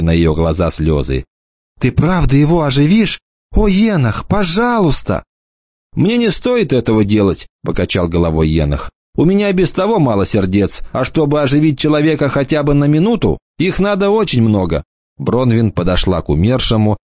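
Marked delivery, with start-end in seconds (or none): 1.8-5.2 put-on higher, begging girl's voice; tearful girl pleading for her brother. Bronwyn begs to revive brother. 5.9-14.8 a hint of a teasing young lad, pitched up; light mocking boy's voice; lightly voiced cocky boy. Enoch's voice, narrated.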